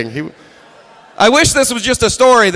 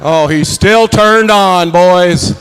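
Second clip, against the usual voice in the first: slow, louder taunting drawl; mocking drill instructor; 3 dB louder, much slower than usual. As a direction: taunt the recruits with a slow sneer. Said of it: "Drill instructor was making it worse, taunting".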